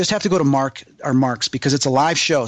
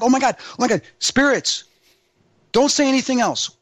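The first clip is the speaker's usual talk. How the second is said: imitated excitement; 9 semitones higher than usual. Acting out Zach's excited reaction.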